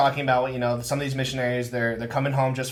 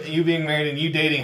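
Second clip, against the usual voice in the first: usual speaking voice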